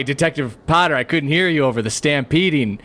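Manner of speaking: normal talking voice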